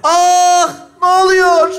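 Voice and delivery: loud high outcry; cry of alarm. Acted. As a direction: yell out as if just hit. Cries out when bag is thrown.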